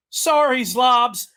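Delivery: apologetic creature. Acted. Creature apologizing to a teammate before exploding, high loud apology.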